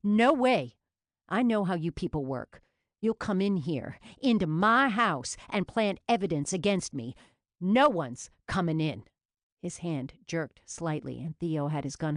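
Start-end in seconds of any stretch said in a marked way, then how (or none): 0.0-9.1 loud, angry refusal; snarling furious shout. A narrator's reading. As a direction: snarl it out in fury.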